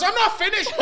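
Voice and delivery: high, loud, indignant cut-in; mock angry interruption. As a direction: cut him off like a fuming flatmate. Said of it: "he's just ranting at me and he's screaming".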